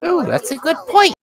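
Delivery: squeaky high-pitched delight; high character voice. Acted. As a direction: chirp it in a high voice.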